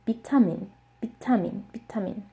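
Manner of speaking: vitamin with a p sound, bitamin said as pitamin; Korean pronunciation. Shown as a demonstration. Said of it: "It's pronounced".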